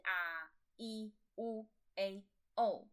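啊、E、U、A、O as five separate pure vowels: ah, ee, oo, eh, oh; vowel demonstration. Shown as a demonstration. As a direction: sound out 啊、E、U、A、O one at a time. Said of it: "A的话我们泽".

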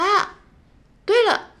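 light up as the thought strikes; sudden idea. Acted. Cake shop owner suddenly remembering something, surprised exclamation.

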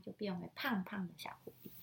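quick unvoiced whisper. Real, not acted. Reader explains softly to child. (快速耳语)

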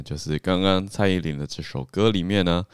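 usual speaking voice

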